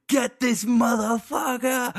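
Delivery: high, slow, hammed-up indignant voice; mock shrill outrage. Mocking Kathy Griffin's tweet at him, high and slow.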